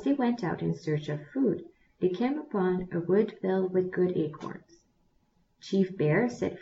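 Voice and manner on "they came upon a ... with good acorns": usual speaking voice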